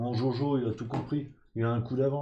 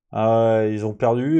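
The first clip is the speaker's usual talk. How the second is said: excited; 10 dB louder than usual. Real, not acted. Reacts to match photo.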